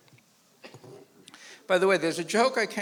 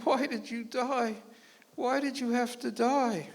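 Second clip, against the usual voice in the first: slow, higher wailing lament; mock widow's wail; piteous crying; 6 semitones higher, slower than usual. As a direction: mourn at the graveside, drawn out. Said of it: "piteously crying".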